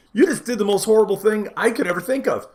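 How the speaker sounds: normal talking voice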